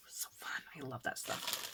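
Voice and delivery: soft, voiceless whisper of delight. Real, not acted. Crafter whispering how much she loves her supplies. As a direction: whisper it lovingly to yourself.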